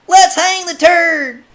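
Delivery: high, loud rough call; thug calling out. Acted. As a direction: bellow it from the back. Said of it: "called an ugly thug".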